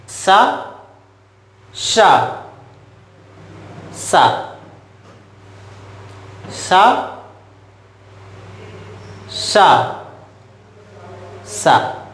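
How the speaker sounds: शा, षा, सा each sounded alone, twice through; sibilant contrast demo. Shown as a demonstration. Teacher showing the difference in pronunciation, sibilant contrast demo. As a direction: drill शा, षा, सा with a clear pause after each. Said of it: "उच्चारण में यही अंतर है".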